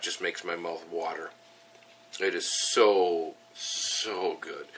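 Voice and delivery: usual speaking voice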